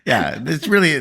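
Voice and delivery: ordinary talk, nothing marked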